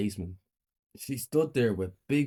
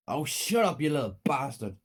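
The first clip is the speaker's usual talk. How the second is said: raised-pitch angry scolding, easing off; angry mother; 5 semitones higher than usual. A narrator's reading. Mother telling crying Ted off, angry. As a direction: scold the child harshly, pitch up at first.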